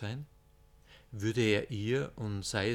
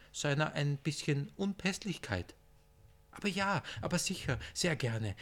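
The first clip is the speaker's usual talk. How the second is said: hoch und überschwänglich freundlich am Telefon; Hakim am Telefon; 6 semitones higher than usual. Acted. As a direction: betont höflich, fast säuselnd, viel höher. (Hakim flötet überfreundlich ins Telefon, hohe Stimme)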